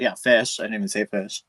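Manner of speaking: ordinary talk, nothing marked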